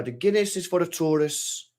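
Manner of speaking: clumsy Irish lilt; bad Irish accent. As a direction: pose as a Cork barman with a poor accent. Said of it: "It's just a terrible accent".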